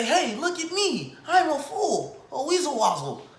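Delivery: high, silly self-mocking voice; mock fool voice. Mocking his own people as fools, high and silly. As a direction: play the proud fool. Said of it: "We say".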